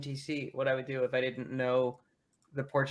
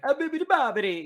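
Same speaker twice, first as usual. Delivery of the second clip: put-on high, loud gibberish in a joke Italian accent; hammed-up Italian accent; mock Italian; 9 semitones higher, 5 dB louder than usual. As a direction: imitate cartoonish fake Italian. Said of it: "You can't speak Italian".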